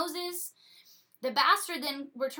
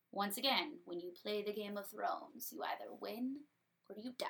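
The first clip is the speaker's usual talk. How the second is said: much softer, hushed dramatic aside; ominous aside; 2 semitones lower, 9 dB quieter than usual. A narrator's reading. Telling the audience the rules of the game, hushed. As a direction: drop it low like a secret warning.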